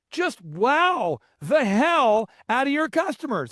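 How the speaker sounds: slow, theatrical drawn-out delivery; Michael Levine. Retold or imitated. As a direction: draw it out grandly, pitched high. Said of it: "And this is how he talks".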